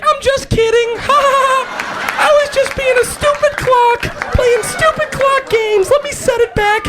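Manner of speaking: very high, squeaky prankster clock; talking clock. Acted. Clock on little legs says it was just kidding, squeaky teasing voice. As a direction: squeal it like a tiny cartoon clock. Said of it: "sprout little legs and be like".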